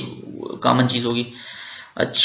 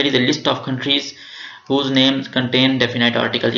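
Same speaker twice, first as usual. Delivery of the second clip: slow careful reading; much slower than usual. Shown as a demonstration. Teacher rereads English heading slowly.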